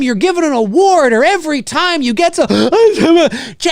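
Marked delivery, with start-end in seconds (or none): 2.1-3.7 a hammed-up gasp mid-sentence, slow; mock crying gasp. Mocking an actress fake crying at an award, gasping. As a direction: fake a choked sob.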